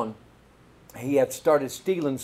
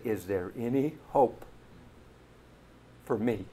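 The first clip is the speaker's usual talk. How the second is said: soft, slow, lower plea; tearful; 4 semitones lower, 7 dB quieter than usual. Retold or imitated. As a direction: hold back tears as the boy. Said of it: "tears begin to come out of his eyes".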